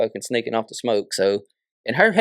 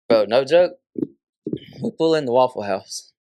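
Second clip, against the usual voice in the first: ordinary talk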